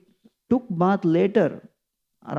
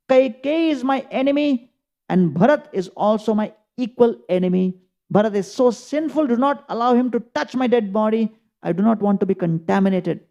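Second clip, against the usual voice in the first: bitter condemnation; 6 semitones higher than usual. Acted. Dying king condemns Bharat.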